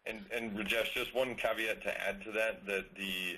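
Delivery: very faint and distant, far from the mic; speaking far too quietly into a weak mic; barely audible. Real, not acted. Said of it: "your voice is very low".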